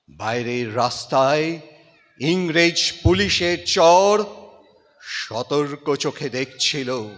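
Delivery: dramatic Bengali recitation, rising to loud peaks and dropping to near silence; impassioned recitation with swelling climaxes and hushed falls; poem recitation. Acted. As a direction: declaim it like a stage tribute to a fallen hero.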